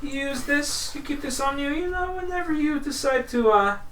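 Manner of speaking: high, nasal-free lilting little gnome; a small gnome pitched well up, selling kindly; gnome merchant voice. Acted. Gnome merchant pitches a healing goo to the adventurer, high and friendly.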